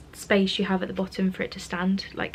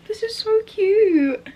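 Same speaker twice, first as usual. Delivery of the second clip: gushing; 13 semitones higher, 8 dB louder, much slower than usual. Real, not acted. Gushing over tote bag.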